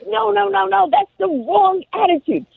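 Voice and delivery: high, mock scolding; scolding mom. Mom correcting son's attitude, high and scolding. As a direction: wag a finger with your voice. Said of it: "I'm like".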